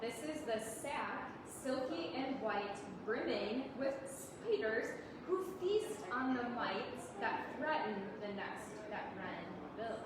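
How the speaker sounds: normal talking voice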